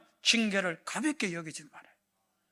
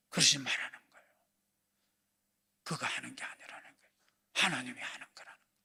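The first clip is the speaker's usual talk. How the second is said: lower, whispered restraint; David's hushed reply; 10 semitones lower, 6 dB quieter, faster than usual. Acted. King David quietly restrains his angry general, in a low whisper. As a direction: hush him gently, quick and low.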